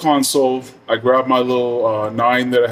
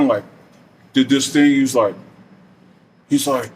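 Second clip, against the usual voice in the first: usual speaking voice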